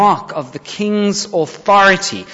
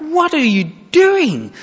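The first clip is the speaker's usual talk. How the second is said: much higher, pleading with David not to waste it; frantic men hissing at their leader; exasperated urging; 12 semitones higher than usual. Acted. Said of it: "are pulling their hair out".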